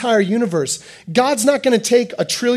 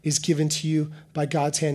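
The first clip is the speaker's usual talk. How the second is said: soft repetition; 5 semitones lower, 7 dB quieter than usual. Shown as a demonstration. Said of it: "repeated softly for emphasis".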